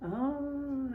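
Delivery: ordinary delivery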